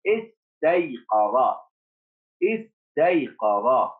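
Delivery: slow, syllable by syllable; slow reading. Shown as a demonstration. Teacher reading slowly to first graders, drawn out. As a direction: stretch each sound for the pupils. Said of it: "teacher models reading".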